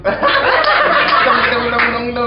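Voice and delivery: laughing through the words. Real, not acted. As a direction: giggle through the stumble. Laughing after stumbling.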